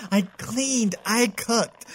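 ordinary talk, nothing marked

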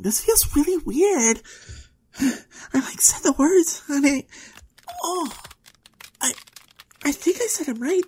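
high, halting, startled voice; rising alarm. Acted. Girl seeing her nails change, alarmed. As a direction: gasp between broken phrases as it spreads. Body begins transforming, alarmed.

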